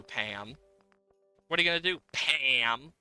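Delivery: ordinary talk, nothing marked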